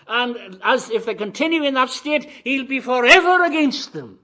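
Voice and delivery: loud, high, emphatic sermon; impassioned preacher at full volume; full-force preaching. Real, not acted. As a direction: thunder it out to the congregation.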